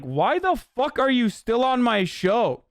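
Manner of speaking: exasperated, high, drawn-out mock outrage; Tucker Carlson. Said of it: "if I'm Tucker Carlson at that moment".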